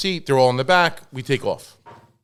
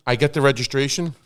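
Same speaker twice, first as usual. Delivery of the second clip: normal talking voice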